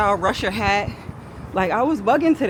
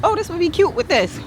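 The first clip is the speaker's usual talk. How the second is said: high excited quote; 10 semitones higher than usual. Retold or imitated. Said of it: "unbox and be like".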